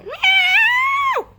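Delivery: piercingly high, loud meow; long squealed cat cry. Acted. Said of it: "where this cat is".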